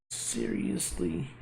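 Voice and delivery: unvoiced rising "Seriously?" said slowly; whispered seriously. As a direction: breathe "Seriously?" softly with a rise. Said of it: "whispered retake of the word".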